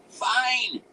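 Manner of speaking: high, loud explosive outburst; wizard's abrupt high scream; sudden scream. A narrator's reading. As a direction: burst out screaming in exasperation. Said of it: "he screamed suddenly".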